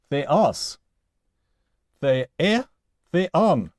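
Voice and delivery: "theos, the-e, theon" each stressed on the final syllable; "theos, the-e, theon" as separate forms, weight on the last syllable. Shown as a demonstration. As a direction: recite "theos, the-e, theon", landing on each ending. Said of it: "on the last syllable of theos".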